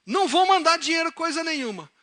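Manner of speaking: very high, loud acted outrage; angry refusal. Father refusing to send his son money, angry. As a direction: blow up, then refuse point-blank. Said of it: "E ficou nervoso".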